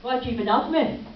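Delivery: put-on loud, slow, indignant teenage yell; teenage son shouting. Son shouting at his mother during a fight, loud and indignant. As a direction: fling it back like a furious teen. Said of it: "and he said".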